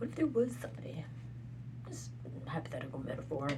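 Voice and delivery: unvoiced hushed musing; whisper. Real, not acted. Wondering aloud about someone else in a whisper. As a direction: breathe the question softly.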